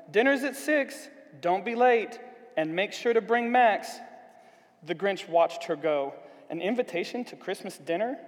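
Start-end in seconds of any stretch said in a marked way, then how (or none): 0.1-4.0 high, loud call from a distance. A narrator's reading. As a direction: call back over your shoulder while leaving.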